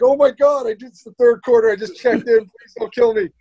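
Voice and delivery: high, pleading fear; mock panic. Rookie facing snarling Garnett, high mock-panicked plea. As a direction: beg for mercy, half joking. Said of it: "and I'm like".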